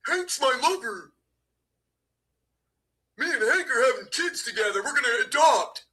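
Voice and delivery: hammed-up mocking voice; mock him as a lovestruck fool; mocking Vinny. Mocking Vinny's love for Hank.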